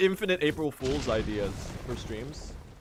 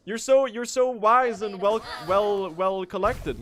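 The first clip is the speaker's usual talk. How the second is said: higher and louder, slowing on the compliment; amazed praise; 8 semitones higher, 7 dB louder, slower than usual. Real, not acted. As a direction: gush like you're honestly amazed. Amazed at a peer's maturity.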